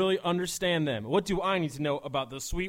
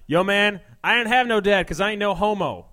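loud, higher jock bro voice; 3 semitones higher, 8 dB louder than usual. Acted. Bro bragging to his buddy, loud and high. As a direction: boom it like a frat bro.

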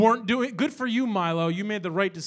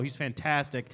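normal talking voice

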